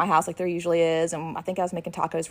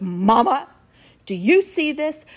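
louder, higher imitation of a child; indignant tattling; 6 semitones higher, 6 dB louder than usual. Sierra comes in to show mom the picture, indignant tattling. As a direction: call Mama in mock urgency.

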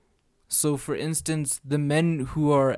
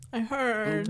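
high, mock auto-tuned wobble; weird voice glitch; 7 semitones higher, 4 dB quieter than usual. Replaying own voice slip, high auto-tune wobble. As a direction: mimic your own voice slipping upward. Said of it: "my voice just did something weird there".

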